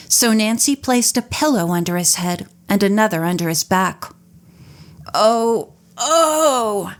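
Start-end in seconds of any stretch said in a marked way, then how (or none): 5.1-6.9 drawn-out pained oh sounds; mock groans. A narrator's reading. Semmet pretending to suffer on the floor, drawn-out groans. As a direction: groan as if barely conscious. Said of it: "Semmet groaned".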